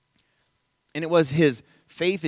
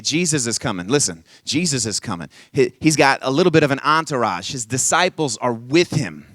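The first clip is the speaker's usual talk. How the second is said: normal talking voice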